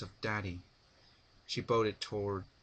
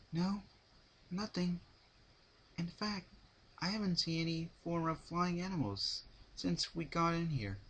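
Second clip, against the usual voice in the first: small and scared, wanting to leave; timid Fluttershy; 9 semitones higher than usual. A narrator's reading. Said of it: "Fluttershy timidly".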